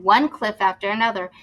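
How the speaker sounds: ordinary delivery, nothing marked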